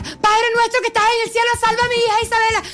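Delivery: high, pleading mother's prayer; frantic prayer. Retold or imitated. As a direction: pray for your daughter in a panic. La mamá reza desesperada. (rezo desesperado y agudo de madre)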